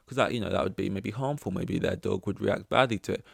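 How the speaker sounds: ordinary delivery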